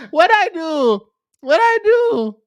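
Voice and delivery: high-pitched, playful "who, me?"; mock-innocent protest. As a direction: squeal it in feigned innocence. Mock-innocent protest at being blamed.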